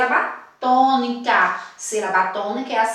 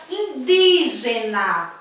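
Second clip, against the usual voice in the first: teacher showing the strong DÍ in indígena; stress on DÍ in indígena; much slower than usual. Shown as a demonstration. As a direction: punch the second syllable of indígena. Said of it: "eu falo a sílaba com mais intensidade".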